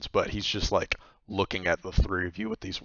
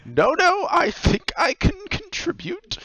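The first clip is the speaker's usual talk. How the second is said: very high, loud squeaky eager voice; eager old man; 8 dB louder than usual. Acted. Grobby eagerly insists to Missus Viaton, high and squeaky.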